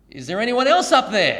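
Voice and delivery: loud, high plea for another rescuer; desperate punchline shout. Retold or imitated. Punchline after long pause.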